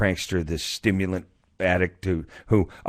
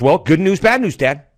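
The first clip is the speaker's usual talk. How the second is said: put-on high, loud child announcing; kid voice; 9 semitones higher, 9 dB louder than usual. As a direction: announce it like a proud little boy.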